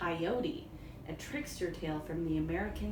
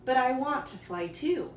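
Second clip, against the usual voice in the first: higher, louder, insistent wish; Coyote voice; 5 semitones higher, 5 dB louder than usual. Acted. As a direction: push it like a needy coyote.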